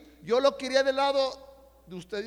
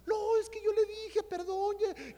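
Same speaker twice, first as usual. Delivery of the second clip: very high-pitched put-on apologetic voice; imitated flustered apology; 11 semitones higher than usual. Mother excusing herself to her son, high-pitched mock apology.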